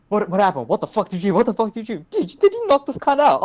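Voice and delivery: mock teacher losing it over the knockout; shocked teacher.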